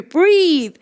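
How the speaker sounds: mild mock yell; mock scream. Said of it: "I'm screaming at you".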